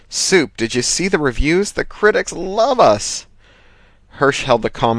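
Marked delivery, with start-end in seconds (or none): 0.1-3.3 gushing producer. A narrator's reading. Thomas gushing over reviews.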